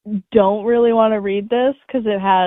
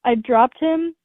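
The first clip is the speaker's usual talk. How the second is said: normal talking voice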